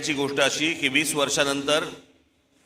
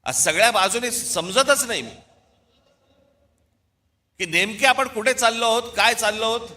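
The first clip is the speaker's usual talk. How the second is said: higher, impassioned questioning oratory; fiery rally speech; 6 semitones higher than usual. Real, not acted. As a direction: demand answers from the crowd.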